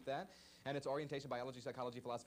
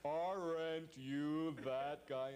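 fan imitation; 3 semitones higher, much slower than usual. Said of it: "usually they do something like".

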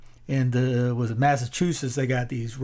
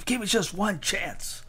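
movie hero quote; 7 semitones higher than usual. Retold or imitated. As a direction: lift the voice into the film hero's plea. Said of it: "talking about that big battle there".

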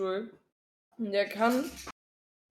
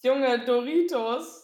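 loud, slow, disbelieving, higher; empört; 5 semitones higher, 9 dB louder, slower than usual. Real, not acted. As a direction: drag it out in outraged disbelief. Outraged at cheap chips suggestion. (entrüstet und gedehnt)

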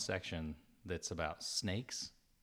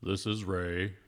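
loud, mock-deep radio intro; hammed-up morning-radio voice; playful low voice; 5 dB louder, slower than usual. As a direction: introduce yourself in a put-on low voice. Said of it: "we can have a low, low voice".

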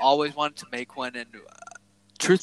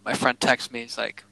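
ordinary delivery

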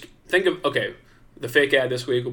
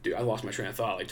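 ordinary delivery

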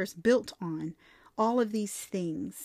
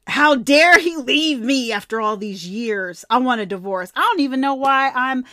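louder, indignant rant; mock angry outburst; 4 semitones higher, 9 dB louder than usual. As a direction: spit it out like a scorned wife. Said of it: "vacillated between anger".

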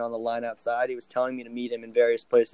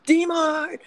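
high-pitched, loud mock scream of a name; excited scream; 5 dB louder than usual. Friend spots him at Monument Park and screams his name, imitated scream. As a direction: yell it out high, as if spotting a friend. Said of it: "and he screams, like".

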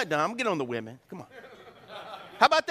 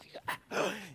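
startled gasp; 11 dB quieter than usual. Retold or imitated. Ducks jet past your ear.

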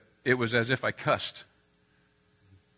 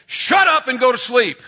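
put-on higher and louder, snapping at the kids; retold angry yell; 10 semitones higher, 10 dB louder than usual. Stressed dad yelling at kids who won't sleep, angry shout. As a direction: bark it like a worn-out dad losing it. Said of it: "opened their door kind of in a rough way".

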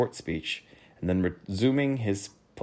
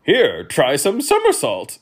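high, loud, slow duke; duke's voice; 8 semitones higher, 10 dB louder than usual. A narrator's reading. Duke suggesting food to a guest, high and loud. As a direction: offer it loudly in a high character voice. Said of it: "suggested the duke".

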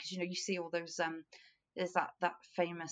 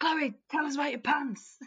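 hammed-up interviewer; 9 semitones higher, 6 dB louder than usual. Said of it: "I'm like".

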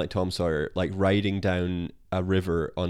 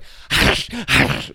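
mock crocodile cackle; 5 dB louder than usual. Crocodile voiced after eating teeth.

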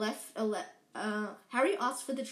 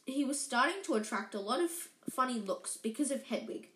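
ordinary delivery

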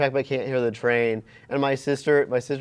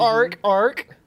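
seal bark; 8 semitones higher, 4 dB louder than usual. Pretend. Seal bark running joke.

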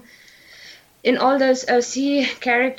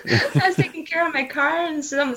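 normal talking voice

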